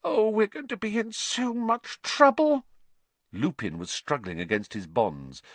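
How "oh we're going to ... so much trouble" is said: high, panicky wail; dreading the punishment, pitched way up; frightened whimper. A narrator's reading. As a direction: fret in a very high girl's voice. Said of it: "with frightened eyes".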